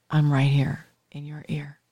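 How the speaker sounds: hushed, as if at the listener's ear; intimate near-whisper. Shown as a demonstration. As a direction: drop to a close, confiding whisper. Showing intimacy of radio voice.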